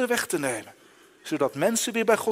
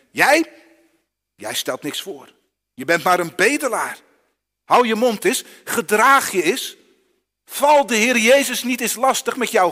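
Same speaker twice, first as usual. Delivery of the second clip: loud, higher, sneering rebuke; scolding crowd; 5 semitones higher, 6 dB louder than usual. Acted. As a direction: put the beggar in his place.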